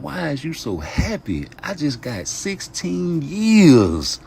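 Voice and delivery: high, rising disbelief, last line slow and loud; incredulous younger self. Retold or imitated. Son asking his mother why she's happy, in a high stunned voice. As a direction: ask in stunned disbelief, dragging out the sentence. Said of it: "And I was like".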